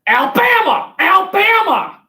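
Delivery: high, shouted Forrest Gump cry of Alabama; Forrest Gump yell. Retold or imitated. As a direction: belt it out like Forrest Gump calling home. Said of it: "Yeah, Forrest Gump".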